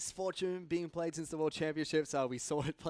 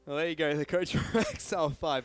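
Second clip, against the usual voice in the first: laughing through words; 3 semitones higher, 7 dB louder than usual. Real, not acted. Still laughing after the joke.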